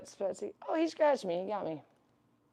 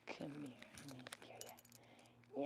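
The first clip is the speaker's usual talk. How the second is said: slow, breathy hush to a frightened pet; soft whisper; 3 semitones lower, 20 dB quieter, slower than usual. Real, not acted. To her scared bunny Casper, a slow soft whisper. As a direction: whisper it slowly so he calms.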